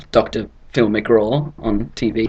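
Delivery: ordinary delivery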